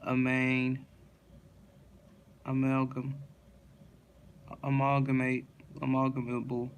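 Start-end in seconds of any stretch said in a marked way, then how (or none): none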